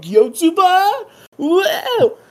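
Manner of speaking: high, loud, drawn-out disbelief; hammed-up shriek of astonishment; mock shock. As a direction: imitate an over-the-top startled reaction. Acting out characters' shocked reaction.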